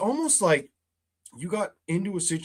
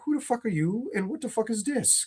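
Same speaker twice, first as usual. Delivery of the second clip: mock baffled outrage; 7 semitones higher, 4 dB quieter than usual. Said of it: "kind of energy".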